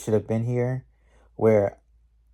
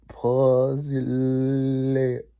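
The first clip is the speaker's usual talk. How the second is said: drawn-out sassy mock delivery; mock sass; 3 semitones higher, much slower than usual. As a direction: stretch it out very slowly, clowning. Clowning the witch's sassy attitude.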